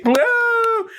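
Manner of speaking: high whooping cheer; a hammed-up crowd's woo. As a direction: whoop like an audience going wild. Audience cheering the performance.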